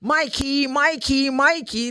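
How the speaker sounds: high-pitched calling, like for a dog. Retold or imitated. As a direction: call out a name in a high singsong. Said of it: "I heard someone calling me".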